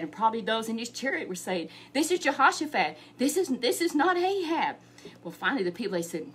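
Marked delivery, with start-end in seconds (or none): 1.9-4.8 higher, louder urgent call; urgent shout. Acted. Chariot men telling enemy soldiers, loud urgent call. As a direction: call out to stop them.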